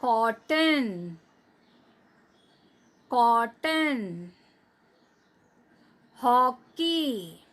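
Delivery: कॉटन and हॉकी drawn out with a wide open ऑ; spaced, exaggerated model for pupils; slow model words. Shown as a demonstration. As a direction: say each word very slowly for the class to repeat. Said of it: "मैं teacher के पीछे बोलिए".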